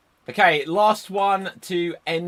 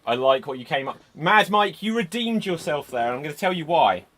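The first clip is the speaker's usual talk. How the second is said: usual speaking voice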